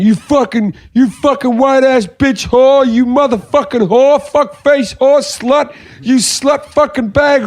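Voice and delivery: high, slow mock tirade; imitated yelling abuse. Storyteller imitates the guy yelling at barista, high mock tirade. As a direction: draw out each insult in a high mock rant. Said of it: "this guy's going".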